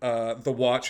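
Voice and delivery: normal talking voice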